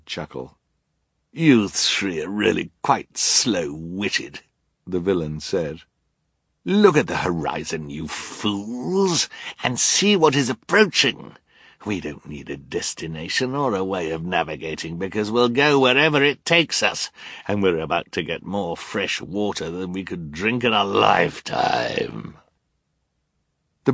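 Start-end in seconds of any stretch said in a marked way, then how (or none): 1.3-4.4 villain voice. Acted. Narrator voices villain Count Olaf. 6.7-22.4 villain voice. Acted. Narrator voices villain Count Olaf.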